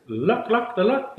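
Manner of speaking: high-pitched honking goose call, imitated; goose call imitation. Guide imitating the geese he heard coming, high honking call. Geese calling over the cliff.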